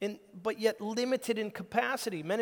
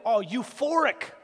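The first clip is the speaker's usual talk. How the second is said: mock euphoric; 2 semitones higher, 8 dB louder, slower than usual. Mock enthusiastic answer.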